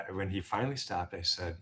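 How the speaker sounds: ordinary talk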